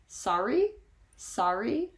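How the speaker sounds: sorry as a question, pitch sweeping up at the end; questioning rise. Shown as a demonstration. Asking someone to repeat themselves, sorry as a rising question. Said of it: "as a question in order to ask them to repeat".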